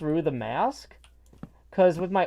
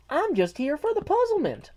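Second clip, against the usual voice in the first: put-on very high-pitched quote in own accent; non-British chat voice; 12 semitones higher than usual. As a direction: read the chat message way up high. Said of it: "Although she's got a British voice".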